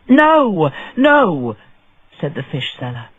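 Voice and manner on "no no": louder, higher quick no no; urgent protest. A narrator's reading. Fish seller interrupting the judge, urgent. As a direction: cut in to stop the judge.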